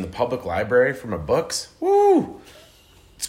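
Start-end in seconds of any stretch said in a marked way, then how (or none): 1.8-2.4 excited cheer. Real, not acted. Cheering for the public library.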